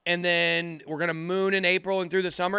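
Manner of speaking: ordinary talk, nothing marked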